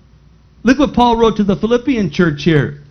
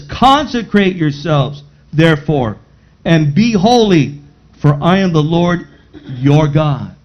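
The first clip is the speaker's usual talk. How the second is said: lower, measured Bible reading; scripture reading; 5 semitones lower than usual. Acted. Preacher reads scripture aloud.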